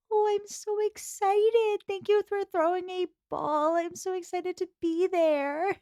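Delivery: high, slow breathless gush; sugary high mock-excited Jane. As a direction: squeal like a sweet eager girl. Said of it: "It's just very cute".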